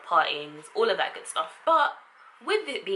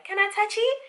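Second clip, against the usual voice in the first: much higher silly voice; mock intruder; 13 semitones higher, 4 dB louder than usual. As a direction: play the clueless hair-toucher.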